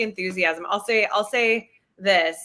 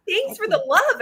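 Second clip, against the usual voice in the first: much higher, gushing mock quote; gushing thanks; 12 semitones higher, 3 dB louder than usual. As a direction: gush it in a high voice. Quotes own thanks to fans.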